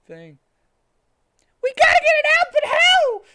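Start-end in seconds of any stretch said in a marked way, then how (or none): 1.6-3.2 shrieking high panic scream. A narrator's reading. Amy screaming at the funeral crowd in panic. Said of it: "screamed Amy and started to cry".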